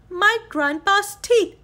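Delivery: high, loud cry of alarm. A narrator's reading. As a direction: shout after the teeth as they vanish. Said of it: "cried Manny".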